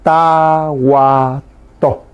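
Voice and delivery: "tahuató" very slowly, stretched into ta-hua-tó; sílaba por sílaba. Shown as a demonstration. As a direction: draw out each syllable of "tahuató" for learners. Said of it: "se dice". ("tahuató" muy lento, separando cada sílaba)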